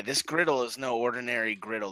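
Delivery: ordinary delivery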